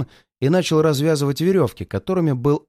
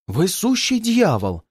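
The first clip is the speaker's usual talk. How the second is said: slow, higher-pitched exclamation; exclaiming; 5 semitones higher, slower than usual. A narrator's reading. Detective reacts to the murderess's confession, exclaiming. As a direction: exclaim it slowly, stretching the words. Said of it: "воскликнул Боб".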